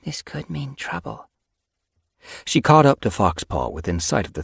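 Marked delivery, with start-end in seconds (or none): 0.0-1.3 soft, hushed warning, slightly raised pitch; softly mewed. A narrator's reading. Apprentice senses trouble ahead, softly. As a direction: say it softly, barely above a hush. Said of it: "she mewed softly".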